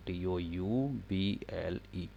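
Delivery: each letter spelled out slowly; dictating the spelling. Shown as a demonstration. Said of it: "sorry, double interrogative".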